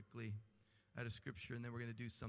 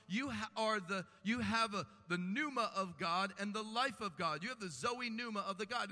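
raised preaching; 8 semitones higher, 7 dB louder than usual. Acted. Preaching zoe pneuma.

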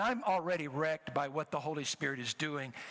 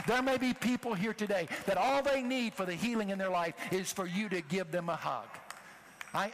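preaching at full force; 5 semitones higher than usual. Real, not acted. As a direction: drive the point home to the congregation. Preaching climax.

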